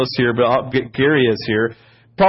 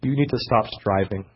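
soft, lowered imitation; Ella's quiet admonition; 4 semitones lower, 6 dB quieter than usual. Woman tells stressed leader to stop, soft and low. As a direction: gently retell a quiet word of correction. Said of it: "She goes".

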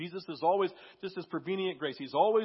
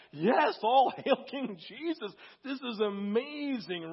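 higher, louder, drawn-out elation; elated agreement; 6 semitones higher, 4 dB louder, slower than usual. Retold or imitated. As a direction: relive the thrill of worship, slow and high. Reliving his joy during worship.

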